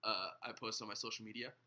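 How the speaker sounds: low, quiet, halting mumble; mock hesitant answer. Pastor mimicking a woke claimant caught by a question, hesitant. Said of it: "they'll be like".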